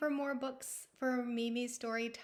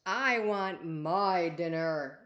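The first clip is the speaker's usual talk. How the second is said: beastie voice; 2 semitones lower, 8 dB louder, slower than usual. Acted. Beastie demanding dinner from the princess, loud and slow. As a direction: rumble it like a hungry beast.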